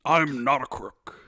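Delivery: low, slow Nixon voice; Richard Nixon impression. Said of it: "Richard Nixon".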